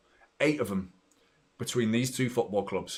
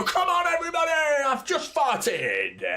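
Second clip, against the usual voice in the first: mock stadium announcer. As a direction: parody a PA announcer whipping up the crowd. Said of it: "they go".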